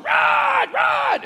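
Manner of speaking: very high, drawn-out sideline yell; imitating a strained coach yelling; coach's practice yell. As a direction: holler it across a football field, high and cracking. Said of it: "at Stanley football practice going".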